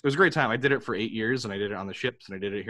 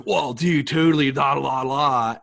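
surfer voice; 5 semitones higher, 9 dB louder than usual. Retold or imitated. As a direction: play the spaced-out surfer dude. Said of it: "Can you do a surfer voice?".

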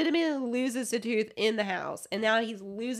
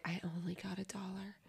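whispered, much softer; whispered complaint; 4 semitones lower, 16 dB quieter than usual. Retold or imitated. Son complaining about his dollar, whispered. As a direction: whine it under your breath.

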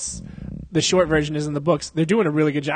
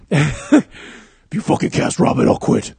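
loud, rasping whisper-growl; hammed-up throaty superhero voice; 7 dB louder than usual. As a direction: growl out a threat as Batman.

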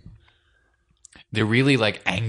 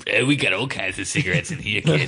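lightly voiced gruff street hustler; a hint of a shady older guy offering smokes; tough street recruiter.

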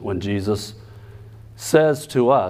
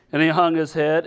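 ordinary talk

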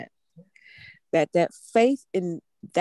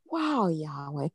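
wonder and delight; 2 semitones higher, slower than usual. Pretend. Preacher acting a believer amazed by Yahweh. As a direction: marvel like it's brand new. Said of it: "with wonder and delight".

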